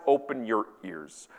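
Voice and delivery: deadpan quote. Acted. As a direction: read out the rebuke slowly and understated. Quoting rebuke within the tweet.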